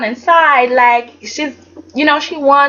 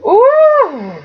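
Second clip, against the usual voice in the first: impressed gasp; much slower than usual. Real, not acted. Reacting to Katie's burn.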